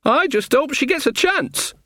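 usual speaking voice

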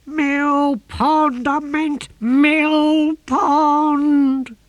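very high, loud squawk, words drawn out; yelling tortoise. A narrator's reading. Tortoise tossed in his box at sea, high drawn-out yell.

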